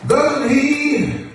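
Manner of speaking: projected sermon cadence in short, held phrases; preaching. Acted. Preacher assures the church that the Lord always provides, preaching. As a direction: stretch key words, then pause for the congregation.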